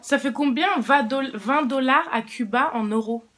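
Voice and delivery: loud, high repeat for a hard-of-hearing listener; repeating loudly. Real, not acted. Repeating the question to deaf father.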